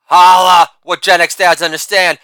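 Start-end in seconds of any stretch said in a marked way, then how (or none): 0.0-0.7 shouted catchphrase. Acted. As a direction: belt the sign-off like a showman milking applause.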